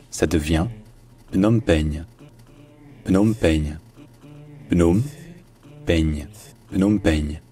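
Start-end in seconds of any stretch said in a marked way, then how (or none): 1.3-7.4 "Phnom Penh" dit pnôm-pègne, mots bien détachés; prononciation francisée. Shown as a demonstration. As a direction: finir "Penh" comme peigne. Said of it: "en francisant le mot". (« Phnom Penh » francisé, pnôm-pègne)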